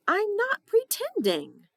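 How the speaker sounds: high, loud protest; protesting loudly. Acted. Emily protesting in sleep.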